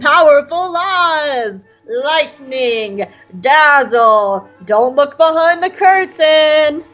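hammed-up, drawn-out grand announcement; grandiose showman. Announcing the great Oz to visitors, grandiose. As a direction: proclaim it like a mock wizard, stretching the end.

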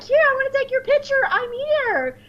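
hammed-up Jesus greeting his flock; squeaky high jubilant Jesus; imitating cosplay Jesus. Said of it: "he starts trying to take selfies".

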